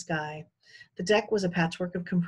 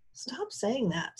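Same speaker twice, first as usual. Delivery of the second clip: exasperated; 6 semitones higher, 4 dB quieter, much slower than usual. A narrator's reading.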